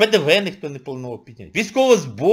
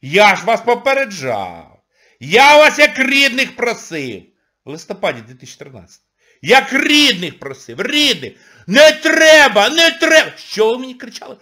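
loud scolding; 7 semitones higher, 9 dB louder than usual. Real, not acted. Scolding the audience, I warned you.

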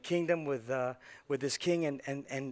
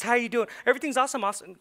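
higher, louder phony greeting; put-on breezy small talk, pitched up; fake superficial cheer; 8 semitones higher, 6 dB louder, much faster than usual. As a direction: gush it like someone who doesn't really care. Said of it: "not be this superficial person that says".

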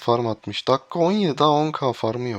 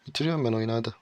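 ordinary talk, nothing marked

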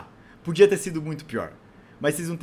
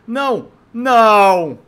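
loud desperate shout; panicked yell; 5 semitones higher, 13 dB louder, much slower than usual. Real, not acted. About to fall in game.

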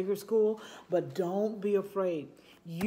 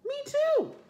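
very high little voice; tiny child voice; 4 dB louder than usual. Acted. Small classmate answering the girl, very high squeak.

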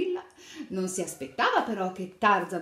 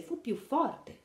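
usual speaking voice

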